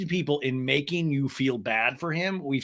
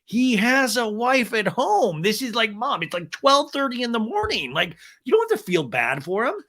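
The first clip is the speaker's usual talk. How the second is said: higher, louder exasperated protest; 8 semitones higher, 3 dB louder than usual. Retold or imitated. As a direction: plead with mom, incredulous. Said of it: "And I'm like".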